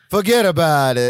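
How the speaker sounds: a hammed-up New York "forget about it"; New York accent. Co-host doing his New York accent for the podcast, hammed up. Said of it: "let me do my New York".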